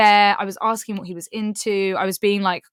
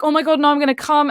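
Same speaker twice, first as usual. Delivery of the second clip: higher, louder, fast mock panic; mock panicked whimper; 5 semitones higher, 5 dB louder, faster than usual. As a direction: rattle it off like he's losing control. Imitating him about to climax.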